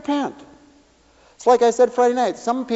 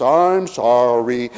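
drawn-out mock sorry; 9 semitones lower, much slower than usual.

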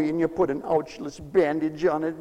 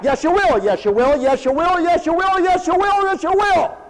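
rising, louder insistence; insistent; 9 semitones higher, 5 dB louder than usual. Acted. Pastor insisting back at the stubborn rebel, rising higher. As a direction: climb higher with each yes, you will.

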